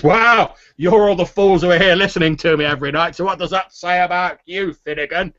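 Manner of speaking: loud, high jovial retort; big-voiced boaster teasing his audience; boisterous tavern storyteller. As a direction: fire back merrily at the hecklers. Haller retorts to the sailors.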